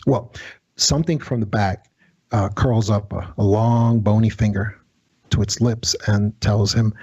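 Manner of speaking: ordinary talk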